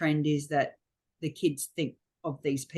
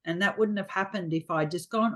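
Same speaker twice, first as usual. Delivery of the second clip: usual speaking voice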